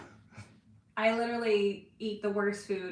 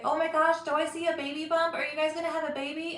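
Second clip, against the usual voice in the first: gushing fan; 5 semitones higher, 5 dB louder than usual. Retold or imitated.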